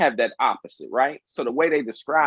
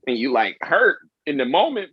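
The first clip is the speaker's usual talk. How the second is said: ordinary talk, nothing marked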